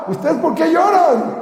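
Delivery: high, slow, amused question; amused counter-question. Acted. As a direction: ask it back with a laugh in the voice. [name] answers laughing.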